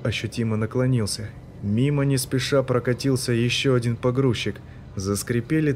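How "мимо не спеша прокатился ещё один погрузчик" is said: ordinary delivery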